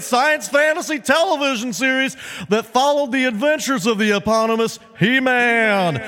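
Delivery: high, slow, booming announcer read; announcer impression. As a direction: boom it out like a cartoon intro announcer. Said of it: "That's my impression of the guy who says He-Man".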